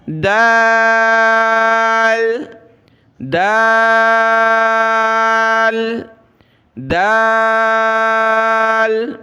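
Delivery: "دال" with the vowel held very long; drawn-out recitation. Shown as a demonstration. Teacher models an easy letter, "دال" drawn out long. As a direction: stretch "دال" on one steady note. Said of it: "اگلا حرف آسان ہے".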